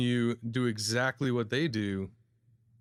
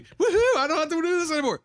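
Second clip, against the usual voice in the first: laughing whoop, much higher and louder; mock celebration; 13 semitones higher, 6 dB louder than usual. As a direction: cheer like a customer freed from masks. Mimics customer celebrating mask news.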